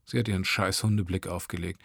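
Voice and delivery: plain reading voice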